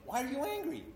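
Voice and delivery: high, parent-like coaxing question; God gently asking. Acted. God asks Cain as a parent would, high gentle coaxing. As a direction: ask high and without force, as to a child. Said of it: "God's asking Cain".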